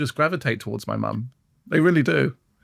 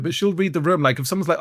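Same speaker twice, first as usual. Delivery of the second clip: ordinary delivery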